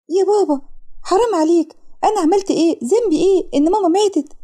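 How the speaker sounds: put-on high-pitched girl pleading with her father; pleading daughter. (بنت بتترجى أبوها)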